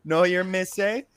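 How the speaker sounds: hammed-up Jamaican patois phrases. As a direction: mimic the patois rhythm, repeating look. Imitating Jamaican patois speech.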